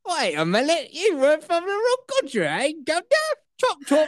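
high-pitched cartoon British; mock British accent. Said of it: "Uh-oh, you turned British".

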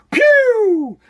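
high, long falling "pew"; rocketing whoosh noise. Shown as a demonstration. Podcaster showing stocks shooting sky high, drawn-out sound effect. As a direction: draw out a high zooming "pew".

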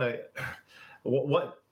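ordinary talk, nothing marked

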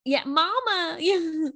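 put-on high little Southern child's drawl; Southern kid accent. Southern kid answering mama, high Southern-accented imitation. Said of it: "a really good Southern accent".

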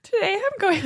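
cranked way up, slow, near a whimper; high-pitched dog voice. Pretend. Owner voicing Minnie in her own voice pitched high. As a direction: squeak like a cartoon puppy. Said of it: "It's just my voice, like, no effect".

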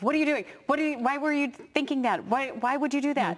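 exasperated reaction. Retold or imitated. Parent reacting to a child's spill, exasperated. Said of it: "you have that initial reaction".

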